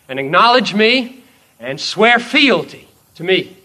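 loud, ringing sermon voice for the king's claim; preaching at full force. Acted. Preacher voicing Jesus to the listener, commanding and loud. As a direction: proclaim the king's demand to the soul, full force.

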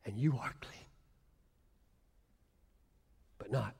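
soft whispered verse. Real, not acted. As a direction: let it fall to a bare whisper.

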